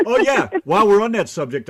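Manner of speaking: loud, high, teasing segue; excited. Real, not acted. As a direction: jump in loud and eager.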